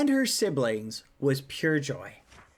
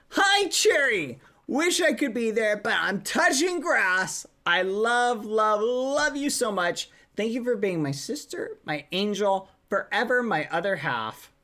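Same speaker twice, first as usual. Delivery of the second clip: gushing excitement; 6 semitones higher than usual. Acted. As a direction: pour out love at a high pitch. Reads gushing message from a sibling.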